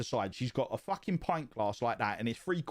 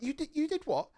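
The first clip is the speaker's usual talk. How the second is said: much higher, incredulous; disbelief; 14 semitones higher than usual. Retold or imitated. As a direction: ask it in stunned disbelief. Hearing wife hit someone.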